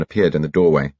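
ordinary delivery

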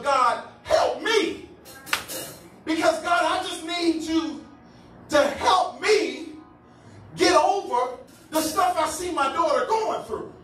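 high, drawn-out plea to God; crying out. Acted. Mother crying out to God for help, pleading. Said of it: "ain't gonna just cry out for God".

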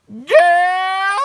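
high, loud, drawn-out mimicry of a tickled auntie; amused auntie impression. Auntie gossiping to mama about angry Jalen, high gleeful hooting. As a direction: hoot it with mock delight, stretching the words.

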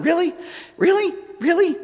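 very high-pitched, incredulous questions; acted disbelief. Penitent thinking about the yelling priest, high mock disbelief. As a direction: imitate a stunned, rising double-take.

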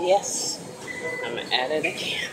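ordinary talk, nothing marked

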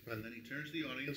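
usual speaking voice

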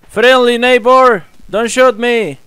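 high, loud, slow call of friendly neighbor. Acted. Calling to the armed neighbor so he won't shoot, high and loud. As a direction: hail a stranger so he holds fire.

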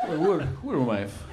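tripping New York mafioso. Acted. Mobster on mushrooms at dinner, lightly voiced, fading. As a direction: drift off as the mushrooms hit. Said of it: "my impression of a guy in the mafia".

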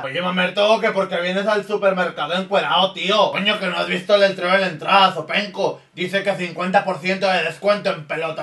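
mock a Galician friend; Spanish accent. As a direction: Spaniard accent telling off his naked friend.

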